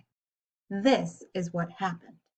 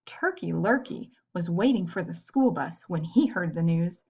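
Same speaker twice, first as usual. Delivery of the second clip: ordinary delivery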